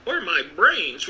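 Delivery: slow, mild tearful wail. Acted. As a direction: draw it out like a lament. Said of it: "wailed the Scarecrow".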